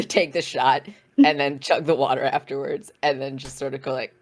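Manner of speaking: normal talking voice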